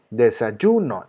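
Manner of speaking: de-sa-YUU-no, syllables spelled out; stress on YU. Shown as a demonstration. Teacher repeats desayuno to show its strongest syllable, stress on YU. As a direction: draw out the YU in "desayuno". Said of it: "La sílaba que suena más fuerte es yu".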